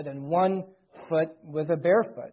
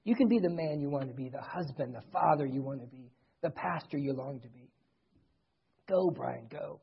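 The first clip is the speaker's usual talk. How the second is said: soft, lower voice of an imagined coach; coach's gentle encouragement; 4 semitones lower, 8 dB quieter than usual. Retold or imitated. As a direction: encourage him quietly, like a personal word from his coach. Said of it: "He'd say".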